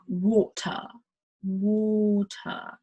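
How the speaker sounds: "water" said as waw-tuh; British water. Shown as a demonstration. Teacher demos the British "water" to learners. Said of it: "it sounds like W A W T U H H H".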